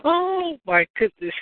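high startled yelp on oh; surprised. Real, not acted. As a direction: caught off guard, then laughing it off. Caught off guard by pregnancy question.

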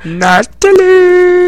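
drawn-out Minnesota-style Natalie; mock Minnesota accent. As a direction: stretch the name out Minnesota style. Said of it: "you got not Cookie Monster accent, Minnesota accent".

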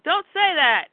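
loud, high protest; upset objection. Acted. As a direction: cut the friend off, stung. Clark cuts off Pete's question.

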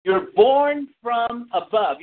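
each word drawn out, slowly and heavily; slow emphatic stress. Acted. Preacher to congregation, slow weighty emphasis. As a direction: lean on every word of it, slowly.

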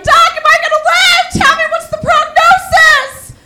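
high, loud frantic plea; hammed-up high panicked voice; panicked patient. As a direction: beg the doctor in a squeaky panic. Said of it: "and he was like".

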